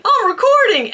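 high-pitched mock excited thought; imitating own thought. Vlogger mimics her mistaken thought that morning, mock excited. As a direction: lift it high, mimicking your earlier self. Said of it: "picture of me thinking".

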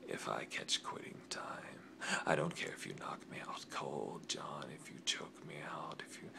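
hushed, intense whispered vow; menacing whisper. Acted. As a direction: swear it under your breath. Threatening rival in promo.

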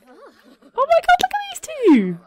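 high, slow squeal of delight; gushing. Real, not acted. Streamer squealing at her sims couple. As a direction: coo over the cute couple.

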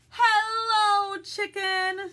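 drawn-out loud duck hello; calling out a greeting. Acted. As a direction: hail a friend high and loud as the duck. Duck calls to Chicken in story.

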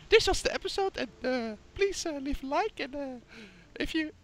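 high goofy announcer voice; squeaky joke sign-off; silly outro voice. Acted.

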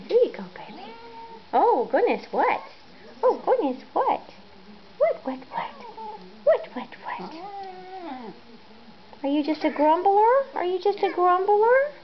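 high, cooing pet talk. Real, not acted. Owner cooing at her grumbling cat in high baby talk.

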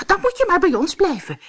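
high, loud, quick invitation; fast high-pitched exclamation; dwarfs calling together. A narrator's reading. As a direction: pipe up eagerly as the little dwarfs. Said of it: "riepen de dwergen tegelijk".

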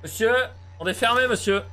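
high, loud call across the room. Real, not acted. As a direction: call the customer to say it's closed. Calling out to a customer.

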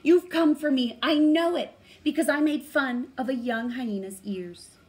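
high, loud fearful confession; frightened pleading. A narrator's reading. Dog fearfully confesses to the Great Spirit.

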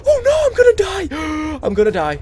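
high, loud panicked scream. Real, not acted. Gamer caught by a monster, high panicked scream. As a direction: scream it as it grabs you.

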